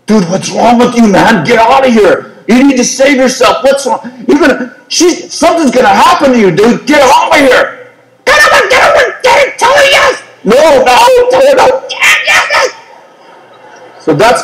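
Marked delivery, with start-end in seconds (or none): 0.1-7.9 higher, urgent warning voice of conscience; cautious inner voice. Acted. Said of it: "there's this other voice saying". 8.2-10.2 very high fast shouted urging; shouting tempter voice. Acted. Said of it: "this other voice". 10.4-12.7 high shouting back and forth, no and yes; two inner voices squabbling, pitched high; inner voices arguing. Acted. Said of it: "voice going on inside of me".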